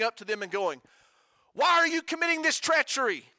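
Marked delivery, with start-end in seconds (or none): 1.5-3.2 sharp, high accusing question; accusing challenge. Retold or imitated. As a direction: confront them as an outraged accuser. Phineas confronting the ten accusingly.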